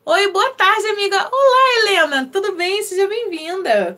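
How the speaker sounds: bright, high greeting for viewers arriving in the live; cheerful high welcome. Real, not acted. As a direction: greet each new viewer warmly and excitedly, pitched high.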